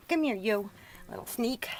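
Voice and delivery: ordinary delivery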